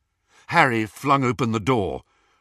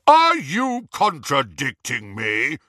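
slow, pompous challenge; blustering; slower than usual. A narrator's reading. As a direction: bluster like an offended official. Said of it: "Harry blustered".

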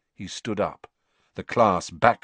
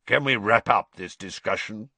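growled. A narrator's reading. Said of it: "growled Moody".

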